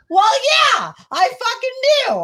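high, loud exasperated shout. Real, not acted. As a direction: yell it out, fed up. Exasperated outburst at partner.

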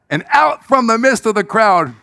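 ordinary talk, nothing marked

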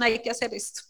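soft, low first try; quiet resolve. Retold or imitated. Telling her husband Nelson, quietly. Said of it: "yo le dije a Nelson".

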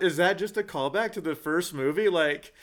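puzzled viewer. Retold or imitated. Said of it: "I'm watching it going".

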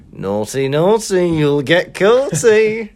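building to high, loud, slow sing-song; sneaky tease. Pretend. Sneaking up on a stranger at the pub, sing-song taunt. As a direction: creep up and taunt. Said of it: "sneak in".

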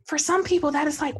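ordinary talk, nothing marked

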